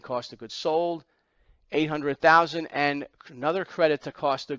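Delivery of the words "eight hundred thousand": ordinary talk, nothing marked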